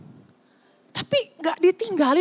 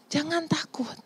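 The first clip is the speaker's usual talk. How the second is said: soft, much quieter comfort; calm them down tenderly; a hint of a soothing Jesus; gentle reassurance; 4 semitones lower, 4 dB quieter than usual. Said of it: "dan bilang".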